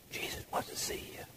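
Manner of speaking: very soft, breath-only whisper; secret whisper. Acted. Martha passing Mary a private message, secret whisper. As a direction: whisper it privately into her ear.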